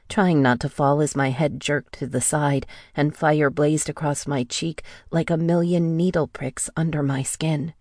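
plain reading voice

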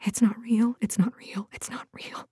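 hushed, fading whisper; barely audible self-soothing murmur; frightened mutter. A narrator's reading. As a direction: mutter it to yourself, fading to almost nothing. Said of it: "and she muttered".

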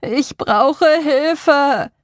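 very slow, high, loud and warped cry; tormented distorted voice. A narrator's reading. Eerie voice imitating Martin drags out a tormented plea. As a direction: drag the plea out like something in pain. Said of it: "klang gequält und verzerrt".

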